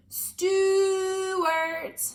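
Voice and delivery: loud high call down the hole; family calls loudly for the lost mouse. Acted. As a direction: shout the name into the mouse hole. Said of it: "Then they all called".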